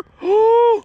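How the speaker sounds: loud, high gasp; high scandalised gasp; shocked gasp. Real, not acted. Shocked at liquor dispenser.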